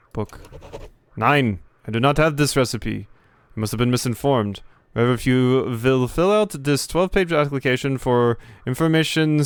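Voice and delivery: put-on stiff officious bureaucrat in a heavy German accent; clipped German-accented official bragging of his credentials. Gamer voicing the German official to the player, German accent. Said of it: "type of speech here".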